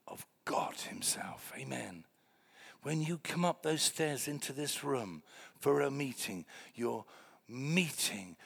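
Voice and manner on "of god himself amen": hushed, reverent whisper. Real, not acted. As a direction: drop to a breath for awe. Hushed close of a point.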